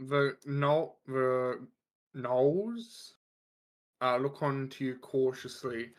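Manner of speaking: normal talking voice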